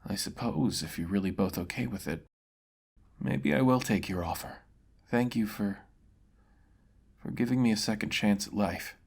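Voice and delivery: a beaten man accepting death; solemn swordsman conceding defeat; defeated mercenary. Acted.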